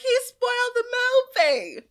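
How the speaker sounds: high, indignant whine; whiny outburst. Retold or imitated. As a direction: whine it like a wronged kid. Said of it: "The way you said that".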